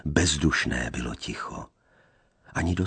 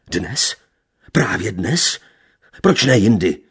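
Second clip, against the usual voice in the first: building from sharp to a much louder, higher demand; suspicious questioning; 5 semitones higher, 8 dB louder than usual. A narrator's reading. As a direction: press her harder with each question.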